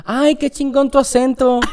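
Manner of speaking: high, drawn-out mock Mexican admiration; imitated Mexican admirer. Mexicans complimenting a Cuban's accent, imitated high-pitched gushing. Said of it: "Los mexicanos".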